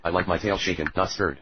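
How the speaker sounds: normal talking voice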